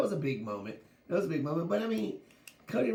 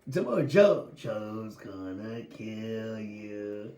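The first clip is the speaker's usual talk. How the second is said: mock menace; 6 semitones lower, much slower than usual. Hyping wrestler menace.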